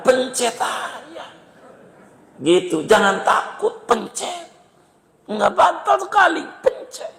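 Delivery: playful urging. Pretend. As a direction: tease them into switching it off. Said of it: "mendesak jamaah matikan HP". (mendesak sambil tertawa dengan suara tinggi)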